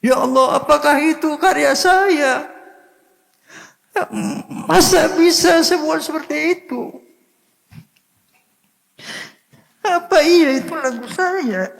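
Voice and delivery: very high, strained crying voice; tearful, overcome. Retold or imitated. Singer to God on hearing his own songs, tearful wonder. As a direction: wail it in disbelief, on the verge of sobbing. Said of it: "Saya menangis".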